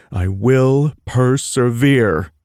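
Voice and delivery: I will per-se-vere, each syllable hit slowly; syllables punched out. Acted. Miles clapping each syllable for his friends. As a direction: beat out every syllable of persevere. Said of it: "clapping my hands to each syllable".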